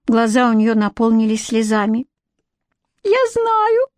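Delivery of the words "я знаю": very high, tiny fairy squeak; tearful squeak. A narrator's reading. Fairy on trial admitting her fault, tearful squeak. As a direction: squeak it out, close to tears. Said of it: "пискнула она".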